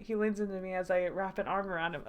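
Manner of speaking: ordinary delivery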